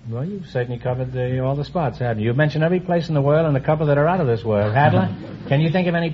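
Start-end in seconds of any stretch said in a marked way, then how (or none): none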